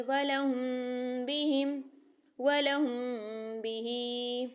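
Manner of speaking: slow वलहुम बिही, meem hidden in a nasal hum before बिही; ikhfa with ghunna. Shown as a demonstration. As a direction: hold the meem through the nose, then release into बिही. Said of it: "कैसे करेंगे?".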